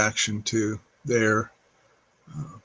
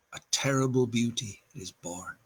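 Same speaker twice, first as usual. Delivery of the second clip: much softer, letting the last refrain fade away; hushed closing line; 8 dB quieter than usual. Acted. Reading the poem's last refrain to listeners, softly hushed. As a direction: drop the voice to almost nothing on the final line.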